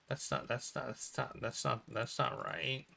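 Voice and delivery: a shaken, faltering murmur; stunned murmur. Acted. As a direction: mumble it in shock. Shocked, fading.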